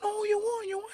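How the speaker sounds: very high squeal, imitating the crying friend; excited screaming. Friend calling from the awards, shrieking with joy. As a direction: mimic a friend shrieking the news. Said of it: "she starts screaming and screaming and she's crying".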